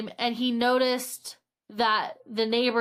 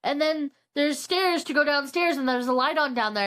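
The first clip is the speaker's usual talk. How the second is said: ordinary delivery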